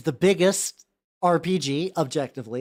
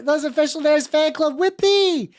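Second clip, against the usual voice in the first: excited cheer; 8 semitones higher, 3 dB louder than usual. Real, not acted. Cheering the fan club name.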